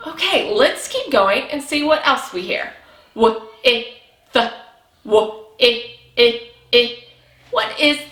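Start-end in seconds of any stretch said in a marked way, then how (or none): 3.1-4.5 "W, I, th" as separate short phonics sounds; sounded out. Shown as a demonstration. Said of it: "ready to stretch it out". 5.0-7.0 "w, I, I, I" as separate short sounds; short "ih" for "I", repeated with gaps; isolated letter sounds. Shown as a demonstration. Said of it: "making our I".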